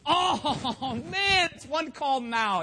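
loud, high, drawn-out exasperated groan into words; hammed-up frustration at bad news; aggravated. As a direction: wail it in mock dismay. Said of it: "so aggravated".

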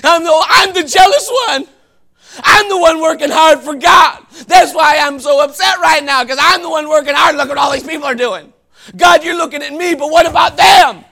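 loud aggrieved whining, voice near breaking; self-pitying whine. Acted. Preacher voicing Elijah complaining to God, whining loudly. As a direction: wail it like a martyr nobody appreciates.

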